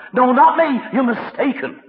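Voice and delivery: high, loud protest; hammed-up panicked insistence; flustered denial. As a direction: insist they have the wrong man, voice jumping up. Acted Peter denying again.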